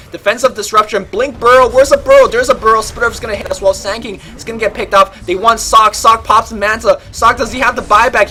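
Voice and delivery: loud, high, rapid fight call; live play-by-play. Acted. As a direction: call the teamfight at full hype. Live team fight commentary.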